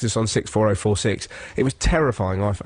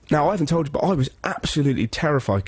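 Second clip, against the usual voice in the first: ordinary delivery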